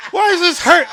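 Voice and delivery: high, very loud pained wail; retold screaming. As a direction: imitate yourself screaming in pain. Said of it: "I screamed my ass off afterwards".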